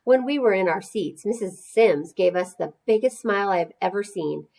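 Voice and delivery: ordinary delivery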